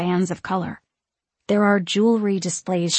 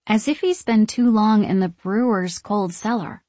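usual speaking voice

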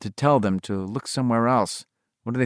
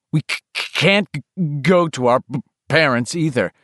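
loud, harsh stammer, louder on each try; stuttering; 4 semitones higher than usual. Acted. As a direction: force the words out over the stutter. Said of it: "His voice sounded harsh ... too loud".

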